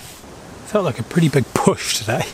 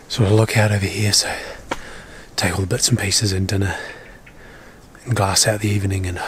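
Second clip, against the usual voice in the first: usual speaking voice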